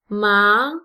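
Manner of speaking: "ma" with an upward glide; second tone. Shown as a demonstration. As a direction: lift the pitch upward through "ma". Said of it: "is the second tone".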